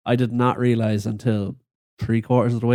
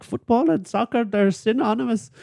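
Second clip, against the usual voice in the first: imitating a bad British accent, higher; lightly mocking British accent, pitched up; 9 semitones higher than usual. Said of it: "she says it in that voice".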